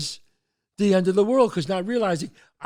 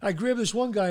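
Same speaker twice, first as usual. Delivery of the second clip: ordinary delivery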